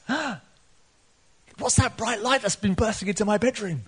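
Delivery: gasp, then high-pitched wonder; excited young son. Retold or imitated. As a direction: imitate a son bursting in amazed. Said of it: "her son comes running in".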